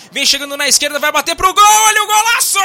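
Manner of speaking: building from tense call to huge goal scream; explosive goal commentary. Real, not acted. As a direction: rise into a stretched, shouted goal.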